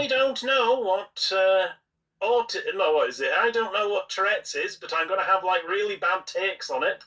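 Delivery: mock-earnest, pitched well above normal; mocking imitation. Podcaster mimicking a commentator with bad takes, mocking. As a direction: put on a high, dim-witted pundit act.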